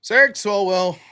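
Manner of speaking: ordinary talk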